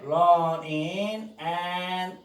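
running and를 D 없이 running an으로; and의 D를 날려 running an으로; dropped D. Shown as a demonstration. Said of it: "D 발음 날리고요". (D 탈락 발음)

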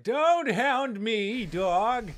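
slow, loud, put-on grumpy voice; grumpy dog voice. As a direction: drag it out like a cranky old dog. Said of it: "the other one's like".

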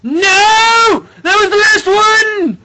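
very high, slow, full-force wail of defeat; anguished screaming. Real, not acted. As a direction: scream every word in despair. Fails at the last obstacle.

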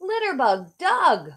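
hero calling out. Acted. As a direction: call his name like a superhero landing. Hero calls out to Doug.

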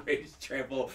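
breathy laugh on the words; laughing echo. Real, not acted. Friend echoing the joke list, laughing. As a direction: giggle the list back.

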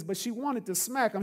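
ordinary delivery

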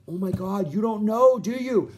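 imitating a friend who can't believe you haven't heard; roommate in disbelief. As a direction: act out the stunned roommate, rising to a near-shout.

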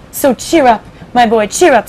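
put-on jolly him along like an old pal; hearty centipede. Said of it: "Centipede said".

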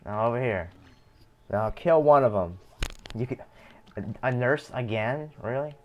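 ordinary delivery

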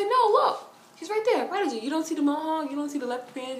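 high imitation of calm friend; calm insistence. Friend pointing out Prodigy to her, calmly insistent. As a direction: point him out in a high, unhurried voice. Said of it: "she was so calm".

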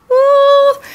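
high, loud whoop of joy; excited cheer. Real, not acted. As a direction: cheer it out happily. Excited cheer about kits.

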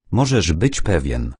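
usual speaking voice

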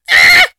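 high-pitched scream. Real, not acted. Player attacked by zombies while driving, screaming. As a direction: let out a startled high scream.

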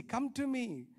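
usual speaking voice

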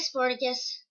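ordinary talk

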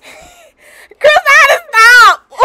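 high, loud burst of laughter; laughing out loud, breaking off the take; laughing shout. Real, not acted. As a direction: crack up and yell it out. Breaks off laughing mid-video.

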